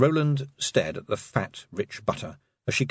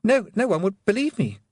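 stammered; 11 semitones higher, 3 dB louder than usual. A narrator's reading. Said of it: "he stammered".

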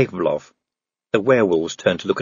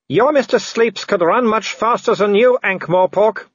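high, loud taunting villain; Wolfgang; 11 semitones higher, 3 dB louder than usual. Acted. Werewolf taunting the man up the tree, high mocking call. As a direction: call out mockingly to cornered prey.

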